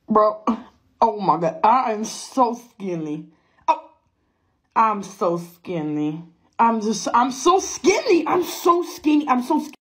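low, slow dude-bro voice; hammed-up low bragging bro; mock bro voice. Weighing herself, mock brag.

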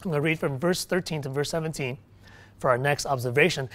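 normal talking voice

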